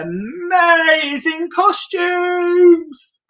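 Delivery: high, slow, drawn-out announcement; excited. Acted. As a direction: present the costumes with big excitement. Showing off Elton's costumes.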